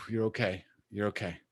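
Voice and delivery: soft, low reassurance to yourself; soothing self-talk. Shown as a demonstration. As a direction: murmur it softly, calming your own chest. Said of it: "So it's like".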